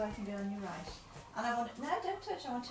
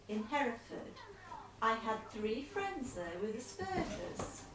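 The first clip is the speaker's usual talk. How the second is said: letter read aloud; 7 dB louder than usual. Acted. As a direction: read the letter out steadily to him.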